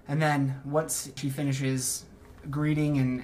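ordinary delivery

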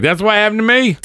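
high, thin imitation of an older lady. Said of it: "She's like".

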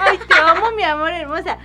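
cooing at a puppy. Retold or imitated. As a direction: lightly coo like to a pet. (imitando con cariño a su perrita)

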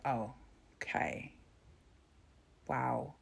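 low, soft disbelief; quietly taken aback, pausing between words; stunned, deflated reaction. Retold or imitated. Reacting to abortion ruling news, quietly stunned.

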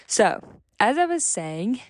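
usual speaking voice

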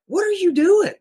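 high, baffled disbelief; caught off guard. Retold or imitated. Homeowner asking the officer aiming at him, high baffled shock. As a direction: pitch shoots up in shocked confusion. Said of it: "I was so caught off guard".

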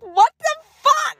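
high, drawn-out yell; imitating an injured friend's high-pitched protest; retold pained outrage. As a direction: cry it out slowly, hurt and outraged. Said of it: "rolling on the ground in pain".

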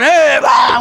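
high, loud mock yell; retold yelling. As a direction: imitate a shouting match. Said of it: "We're just yelling back and forth".

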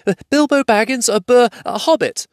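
high, loud frightened stammer; shaking with fear. A narrator's reading. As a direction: stammer it out in terror. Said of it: "shaking all over".

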